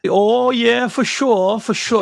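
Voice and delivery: high-pitched put-on Minnesota accent. Guest showing hosts his best Minnesota accent, hammed-up. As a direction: play up a Minnesotan's eager agreement. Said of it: "give us your best Minnesota accent".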